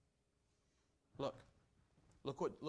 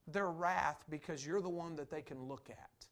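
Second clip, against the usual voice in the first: ordinary delivery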